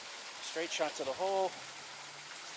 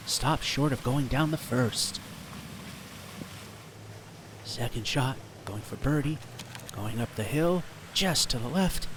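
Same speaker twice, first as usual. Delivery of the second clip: hushed golf play-by-play voice; golf commentator. Acted. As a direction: call each shot like TV golf. Play-by-play of own shots.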